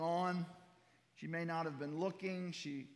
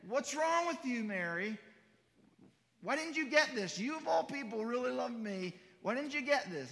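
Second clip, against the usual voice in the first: loud, high-pitched mock reproach; acted telling-off, voice pushed up; exasperated scolding; 7 semitones higher, 5 dB louder than usual. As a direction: scold in disbelief, as if badly let down. Imagined exasperated scolding of Mary.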